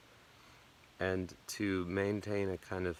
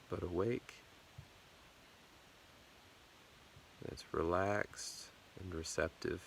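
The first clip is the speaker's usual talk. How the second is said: guided meditation; 6 dB quieter than usual. Acted. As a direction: ease them gently through the body, unhurried. Leads a body scan meditation.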